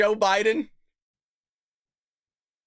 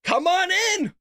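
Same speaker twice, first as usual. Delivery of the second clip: much higher put-on welcome; mock poll worker; 11 semitones higher, 4 dB louder, slower than usual. Poll worker waving him in, high put-on voice. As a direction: chirp it like a cheerful clerk. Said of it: "And she goes".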